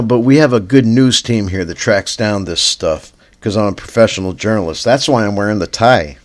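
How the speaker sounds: ordinary talk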